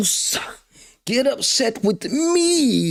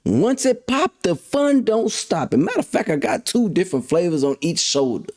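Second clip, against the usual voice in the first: usual speaking voice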